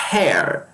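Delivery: "Hair" opened with a puff of breath; English breathy H. Shown as a demonstration. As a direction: huff the H of "Hair" as if fogging glasses. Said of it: "aquele bafozinho para limpar".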